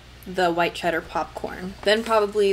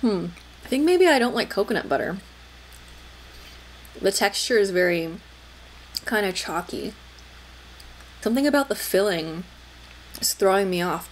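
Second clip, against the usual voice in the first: talking between bites; chewing while talking. Real, not acted. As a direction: mouth partly full of candy. Seen 0: Holds bitten cup, bites repeatedly and chews while speaking.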